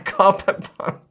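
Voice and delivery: high, loud words squeezed out through laughter; cracking up while repeating the punchline; laughing. Real, not acted. Reading a viewer's joke to the stream, laughing.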